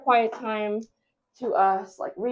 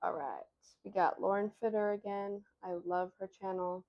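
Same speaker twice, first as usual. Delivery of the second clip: much softer, keeping it down; quiet; 12 dB quieter than usual. Real, not acted. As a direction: murmur it over the workout video. Quiet talk during workout video.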